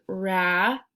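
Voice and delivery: slow read-aloud. Shown as a demonstration. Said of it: "if I look at it".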